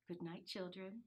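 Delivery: softly whispered good night; whispered goodnight. Acted. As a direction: hush it like leaving a sleeping room. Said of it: "whispered their mother".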